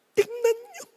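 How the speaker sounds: a lightly voiced emotional announcer; dramatic announcer. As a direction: coax the crowd softly, up high. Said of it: "Sabi nung announcer".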